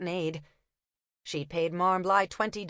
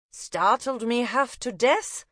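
put-on slow, higher German-accented remark; 5 semitones higher, slower than usual. Una spinning a tale in a German accent. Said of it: "feigning a German accent".

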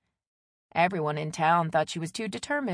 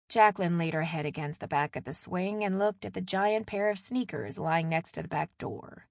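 ordinary delivery

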